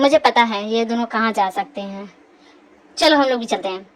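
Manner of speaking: very low male character voice; knowing man. Acted. Man telling the others to follow the pair, assured. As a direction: drop deep and take charge. Man knows where they went.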